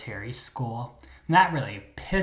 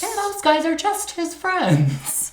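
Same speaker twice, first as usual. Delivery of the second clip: very high, slow put-on voice; hammed-up naive insistence, drawn out; wife in denial; 14 semitones higher, slower than usual. Wife explaining away Herbie's guy friends, mock-naive denial.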